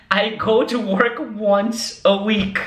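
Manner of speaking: slow and careful, words spaced out; slow model sentence. Shown as a demonstration. Teacher models a sentence for students, slowly and clearly. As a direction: lay it out slowly so students can follow. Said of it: "Então, vamos lá".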